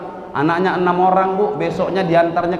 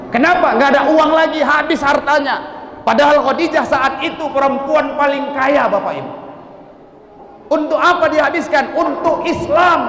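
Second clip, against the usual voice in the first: fervent preaching; 8 semitones higher than usual. Acted. Preacher extolling Khadijah to the congregation, fervent sermon. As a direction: lift the story up with fervour.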